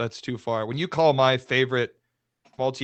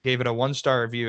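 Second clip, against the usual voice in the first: ordinary talk, nothing marked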